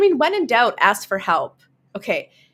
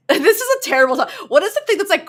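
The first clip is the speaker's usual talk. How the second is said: normal talking voice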